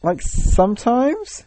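slow, evasive, questioning rise; drawn-out hedging, voice climbing at the end; shady. Real, not acted. Dodging a question about talking to himself, shady. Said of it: "You sound really, really shady right now".

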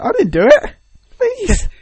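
high, loud protest of innocence; pleading Kinect. Acted. The Kinect pleads with the guy unplugging it, pitifully. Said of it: "the Kinect's just like".

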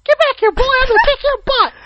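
angry, high-pitched squeaky scolding; loud cartoon-mouse rage, squeaked very high; Mickey Mouse. Scolding a boy who ran off, pissed-off squeaky voice. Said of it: "You sound like pissed off Mickey Mouse".

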